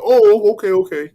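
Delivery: high, loud surprised oh okay; sudden high exclamation; mock surprised oh. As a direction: play the surprised local. Mock reaction of locals.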